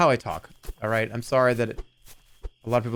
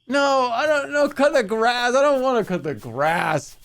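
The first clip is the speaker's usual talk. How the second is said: much higher, louder mock whine of a kid refusing chores; whiny younger self; 12 semitones higher, 4 dB louder than usual. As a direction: whine it like a sulky teen told to mow the lawn. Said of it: "I used to hate the notion of".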